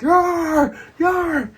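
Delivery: high, loud drawn-out yell; pirate war cry. Retold or imitated. Imitating pirates' battle cry.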